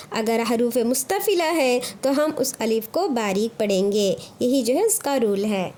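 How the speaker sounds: ordinary talk, nothing marked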